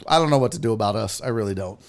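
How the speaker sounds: ordinary delivery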